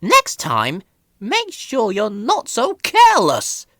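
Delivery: put-on condescending old lorry; Elizabeth, bossy. A narrator's reading. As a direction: tell the little engine off. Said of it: "she said".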